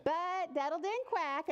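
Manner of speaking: usual speaking voice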